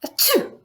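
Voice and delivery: high mock sneeze. Shown as a demonstration. Teacher sneezes while reading the list. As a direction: fake a quick sneeze.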